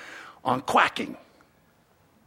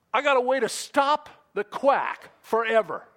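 ordinary talk, nothing marked

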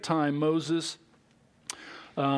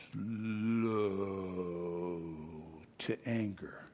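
slow to anger, drawn out and much softer; slowed right down; 10 semitones lower, 9 dB quieter, slower than usual. Shown as a demonstration. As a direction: let each word hang, quiet and slow. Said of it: "You gotta slow it all down when you read that".

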